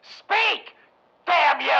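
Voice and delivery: loud, high mocking demands; scornful laugh then shout. Rothman taunting the silent creature, scornful shout. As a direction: sneer at it, then roar the order.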